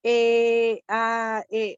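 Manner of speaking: drawn-out hesitation sounds, each set apart; filler-sound demo. Shown as a demonstration. Participant showing what filler words are, hesitant demo. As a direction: demonstrate stalling between words. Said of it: "como el tipo en el que uno dice".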